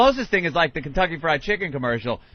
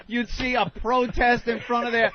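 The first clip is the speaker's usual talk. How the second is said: usual speaking voice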